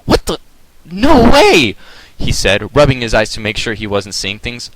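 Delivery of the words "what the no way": high, loud disbelieving cry; disbelief. A narrator's reading. Jet seeing the comet hit, loud high disbelief. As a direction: blurt it out in shock.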